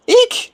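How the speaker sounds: very high startled eek; a little creature's shriek of panic; tiny squeal of fright. Acted.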